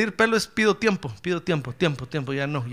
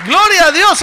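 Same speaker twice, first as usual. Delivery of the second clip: loud, high cry; shouted praise; 11 semitones higher, 16 dB louder than usual. Real, not acted. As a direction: cry out to God. Preacher's own shout.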